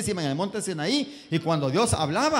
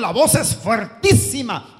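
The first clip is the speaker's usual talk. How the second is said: slow, booming, much louder; mighty voice; 6 semitones higher, 10 dB louder, slower than usual. Acted. Preacher booming out God's mighty voice. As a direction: boom it out like thunder.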